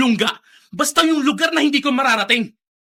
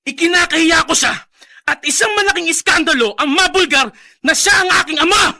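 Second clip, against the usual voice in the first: louder, shouting his shame; ashamed angry shout; 3 semitones higher, 4 dB louder than usual. Acted. Son yelling at his friend about his father. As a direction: spit out the shame at full voice.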